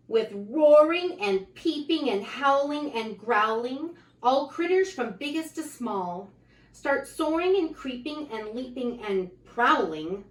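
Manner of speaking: lively, sing-song picture book reading; reading to children. A narrator's reading. Reading a rhyming book aloud to children, lively. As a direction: read the rhymes out brightly to the kids.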